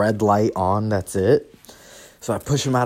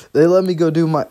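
usual speaking voice

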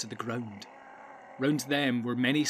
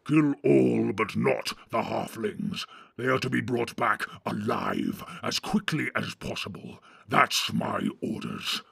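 deep growl. A narrator's reading. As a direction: growl out orders, weighing the words. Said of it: "said a third voice in a deep growl".

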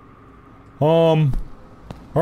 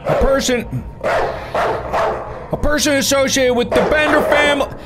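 news announcer; 11 semitones higher than usual. Acted. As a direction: announce it like a radio bulletin. Reads news report aloud.

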